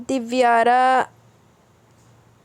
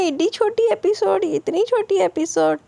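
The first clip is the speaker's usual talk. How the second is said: much higher, put-on voice, mock complaining; mocking a commenter; 9 semitones higher than usual. Narrator imitates a commenter griping about short episodes, high mock complaint. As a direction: squeak out the complaint like a fussy viewer. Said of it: "तो फिर आपने कहना है".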